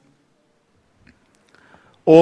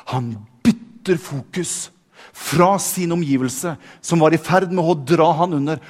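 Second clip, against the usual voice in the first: normal talking voice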